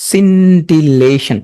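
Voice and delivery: "স্সিন্টিলেশন" stretched syllable by syllable; slow drill. Shown as a demonstration. Teacher showing the spelling, slow and stretched. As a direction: draw out "স্সিন্টিলেশন" slowly.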